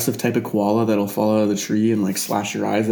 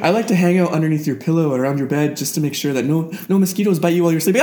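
eager, higher-pitched spider voice; helpful spider; 6 semitones higher than usual. Acted. Spider to sleeping human about mosquitoes, high helpful voice. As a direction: explain it high and helpful, picking up speed.

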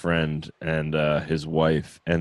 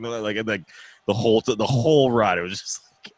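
usual speaking voice

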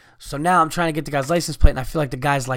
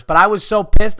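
pissed-off outburst; 5 semitones higher, 7 dB louder than usual. Real, not acted. Ranting about the bus driver.

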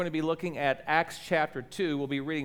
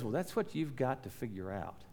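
low, soft, knowing reply; soft knowing Curly; 6 semitones lower, 11 dB quieter than usual. Retold or imitated. Old cowboy quietly answering Mitch, soft and low. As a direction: hand it back quietly and low. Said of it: "And he says".

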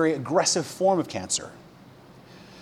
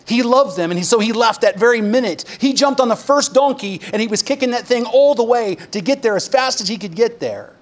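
higher and louder, tumbling with urgency; picture him kicking the donkey to hurry; excited rush; 6 semitones higher, 4 dB louder than usual. Pretend. Pastor picturing Jesus racing to his friends, excited.

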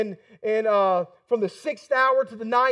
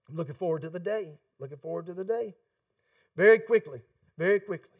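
soft longing; 5 semitones lower, 6 dB quieter, faster than usual. Real, not acted. Quiet longing repeated.

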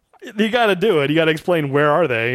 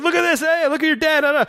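high mocking coworker voice, pitched way up; teasing coworkers; 11 semitones higher than usual. Coworkers taunting Robert about his famous dad, high and mocking. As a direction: jeer like office goofs waving a newspaper.

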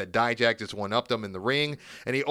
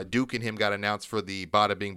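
usual speaking voice

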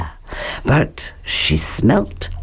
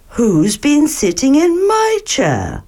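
higher motherly bear voice; higher Mother Bear asking; 9 semitones higher than usual. Acted. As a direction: lift it for Mama Bear.

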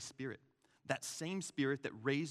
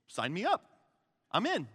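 higher, louder, keen volunteer; eager; 6 semitones higher, 6 dB louder than usual. Acted. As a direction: jump at the offer, rising pitch. Acting eager guests offered free drinks.

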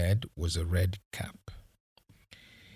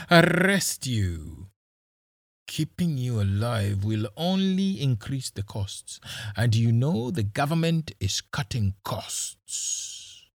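mocking; 4 semitones higher than usual. Policeman mocking captives.

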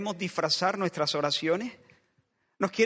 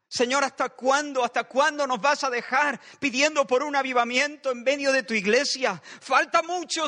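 raised, louder pleading prayer at full force; impassioned prayer; 6 semitones higher, 3 dB louder than usual. Acted. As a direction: cry out to God, pressing and urgent. Preacher voices an anguished prayer. (oración ferviente y suplicante, más aguda y fuerte)

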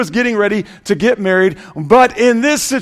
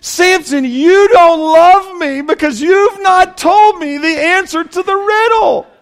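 much higher, pleading mock-whine; whiny bride; 11 semitones higher, 5 dB louder than usual. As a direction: whine at Samson like a nagging bride. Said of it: "she even said this".

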